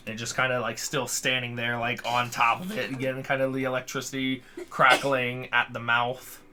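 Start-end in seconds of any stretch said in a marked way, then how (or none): none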